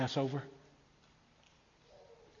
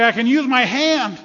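loud, high exclamation; amazed cry out; 7 semitones higher, 7 dB louder than usual. Acted. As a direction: cry out the miracle to all around. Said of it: "I hear somebody else cry".

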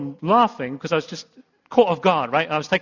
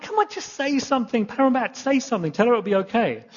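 urgent pleading, higher; 6 semitones higher than usual. Retold or imitated. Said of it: "And so I'm like".

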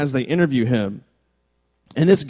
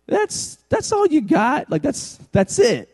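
incredulous disbelief; 11 semitones higher, slower than usual. Retold or imitated. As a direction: pitch jumps way up, incredulous. Mocking the weak defense.

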